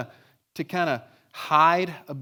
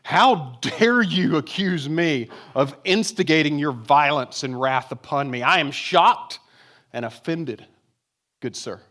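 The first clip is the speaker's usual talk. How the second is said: hammed-up outrage, louder and slowed; mock indignation; 6 dB louder, slower than usual. Boy retelling his protest to the principal, mock outraged. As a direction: play the wronged gentleman, overly formal and offended. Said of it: "I was like".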